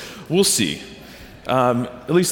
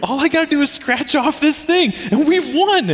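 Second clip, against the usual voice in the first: put-on much higher, eager child voice; excited kid; 10 semitones higher than usual. Son tells dad about scam prize, high excited child voice. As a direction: play the son bursting in with news. Son's voice in retold story.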